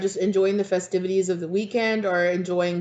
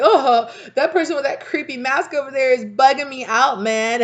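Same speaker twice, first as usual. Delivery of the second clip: high, loud and laughing; amused grumble at a creepy mask; laughing mock complaint; 7 semitones higher, 6 dB louder than usual. Imagined reaction to masked stranger.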